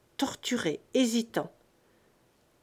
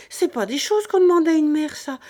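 much higher, louder and fast; outraged shout; 6 semitones higher, 9 dB louder, faster than usual. A narrator's reading. Mother shouting at the rich couple, outraged. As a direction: hurl it back at them as a mother. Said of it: "toute furieuse".

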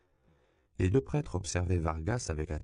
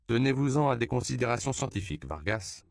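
higher, fast protest; 7 semitones higher, 4 dB louder than usual. A narrator's reading. Father Alfonso protesting to Vargas, high and fast. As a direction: snap back at the doctor. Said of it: "protesta le père Alfonso".

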